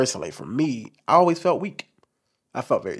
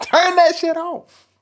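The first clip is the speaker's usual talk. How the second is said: much higher, louder, slow mock mom voice; shrill mom barging in, hammed up; angry mama; 6 dB louder, slower than usual. Mama telling her son to kill the music, high mock scold. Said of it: "my mama used to come in my room and be like".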